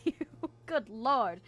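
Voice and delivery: ordinary talk, nothing marked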